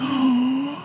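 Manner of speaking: sharp mock gasp; a high, breathy gasp of shock. Retold shocked reaction.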